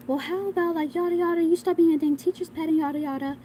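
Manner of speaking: high-pitched mocking tirade; mock screaming. Girl screaming at the storyteller about being teacher's pet, high mock scream. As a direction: imitate her scolding in a high, mock-yelling voice. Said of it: "screaming at me".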